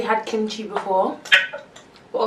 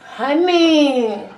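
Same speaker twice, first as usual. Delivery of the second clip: calling out; 6 semitones higher, 4 dB louder than usual. Real, not acted. Calling for honey.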